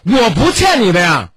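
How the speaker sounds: loud, high, slow rebuke. Shown as a demonstration. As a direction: raise your voice and draw it out. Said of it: "我下边要高声了".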